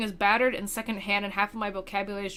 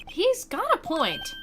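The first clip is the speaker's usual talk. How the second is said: much higher, slow squeaky aside; squeaky character voice; 13 semitones higher, slower than usual. Acted. Side character squeaking a comment in court. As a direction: pitch it way up and drag it.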